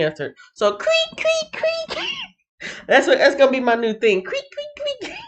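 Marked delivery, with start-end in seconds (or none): 0.8-2.0 high, soft mock chirping of crickets. Imitating crickets, no answers. 4.2-5.2 high, soft mock chirping of crickets. Imitating crickets.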